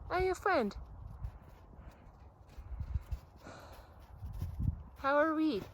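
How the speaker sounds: high sing-song pet talk. Real, not acted. Cooing at animals.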